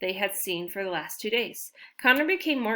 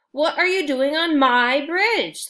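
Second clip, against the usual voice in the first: slow, loud, higher troll demand; 7 semitones higher, 8 dB louder, slower than usual. Acted. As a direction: growl it out as an angry troll. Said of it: "the troll demanded".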